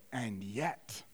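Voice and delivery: hushed, slow German professor; low German-accented lecturer, weighing each word. Retold or imitated. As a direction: speak softly and reverently, German accent. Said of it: "in German accent, I'm gonna do my best".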